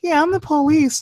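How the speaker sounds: self-mocking police voice. Narrator mocking his own collab part, silly high voice. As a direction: pitch it way up, mocking your own line.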